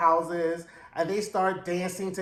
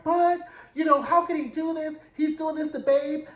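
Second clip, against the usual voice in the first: high, whiny protest; mock high protest; 8 semitones higher, 3 dB louder than usual. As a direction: squeal it out in mock outrage. Slim protesting shrilly in retelling.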